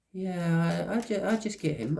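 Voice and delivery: high, put-on voice of whoever opens the door; person answering door.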